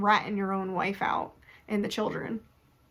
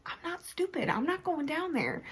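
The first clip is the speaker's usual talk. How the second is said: higher, softer, prim refusal; quiet high Liesel; 7 semitones higher, 7 dB quieter than usual. Retold or imitated. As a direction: tell them flatly you won't go, light and high. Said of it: "she's also like".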